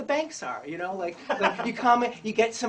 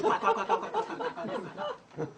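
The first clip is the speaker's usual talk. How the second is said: quacks climbing much higher and thinning out; imitating quacking ducks; 13 semitones higher than usual. Mimicking the whole room quacking like ducks. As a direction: quack like a crowd of ducks going squeaky.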